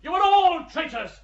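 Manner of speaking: furious accusation. Acted. Stoggenberg accusing the judges of treason, angry shout. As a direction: hurl it at them in rage.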